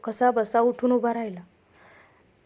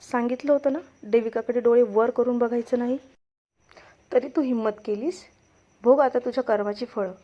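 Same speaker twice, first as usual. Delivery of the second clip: usual speaking voice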